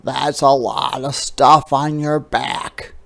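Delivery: loud croaky frog voice; croaking frog. A narrator's reading. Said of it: "croaked Frog".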